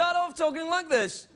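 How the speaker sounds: high-pitched English presenter lilt; English TV presenter opening. Shown as a demonstration. Host mocking British TV presenters, sentence pitched high at the start. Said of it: "talk like an English television presenter".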